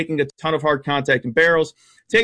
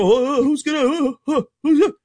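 whiny mock fan panic, pitched up; mocking Cubs fans; 7 semitones higher than usual. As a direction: imitate a worried Cubs fan, higher and rising. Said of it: "I know the Cubs fans go".

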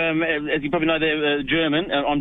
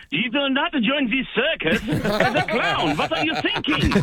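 higher, laughing imitation of shocked parents; mock-appalled parents; 8 semitones higher than usual. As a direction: send up your parents' horror while laughing. Parents react to circus news.

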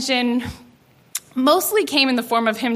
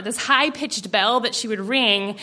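ordinary delivery, nothing marked

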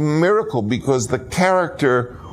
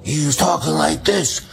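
imitating hoarse voice; 5 semitones higher than usual. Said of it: "maybe his voice should be very hoarse".

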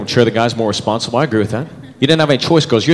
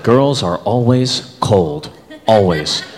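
slow emphasis; 4 semitones lower, much slower than usual. Real, not acted.

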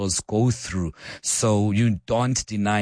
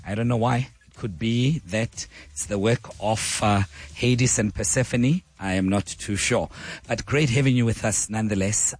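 ordinary delivery

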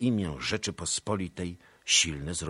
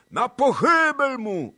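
very high, loud, slow bloodthirsty yell; crowd cry; 14 dB louder, slower than usual. A narrator's reading. Angry Cossacks calling for the Pole's death, yelling. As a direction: howl for his death. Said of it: "ozwały się różne głosy".

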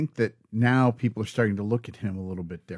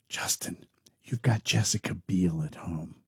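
whispered, lower pointed aside; scolding whisper; 6 semitones lower, 6 dB quieter than usual. Pretend. Host whispering a warning to [name], pointed and hushed. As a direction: hiss it as if leaning in to warn him. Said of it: "here's what I have to say to [name]".

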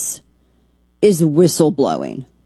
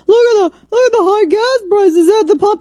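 much higher, louder squeaky mimic of the congresswoman; mocking impression; 4 dB louder than usual. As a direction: mimic her as a shrill cartoon. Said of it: "She did this video".

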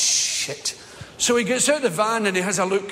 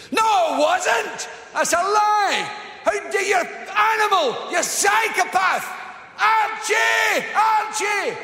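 hysterical shrieking woman; 13 semitones higher, slower than usual. Retold or imitated. Woman shrieking at driver, calling for Archie. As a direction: shriek for the policeman next door.

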